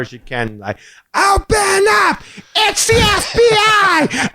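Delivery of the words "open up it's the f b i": high, slow mock FBI yell; crazy kid yelling. Little girl pounding hotel doors, high slow yell. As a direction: bang and holler like a kid playing cop. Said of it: "starts to go crazy and starts banging".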